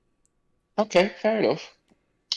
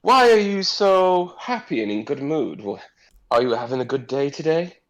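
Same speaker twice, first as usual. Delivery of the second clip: friendly noble; 5 semitones higher than usual. Acted. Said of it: "And he says".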